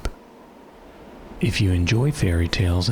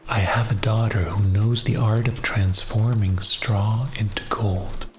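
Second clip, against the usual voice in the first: bedtime story narration. Acted. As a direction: lull the listener toward sleep.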